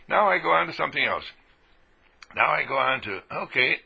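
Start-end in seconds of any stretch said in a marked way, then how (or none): none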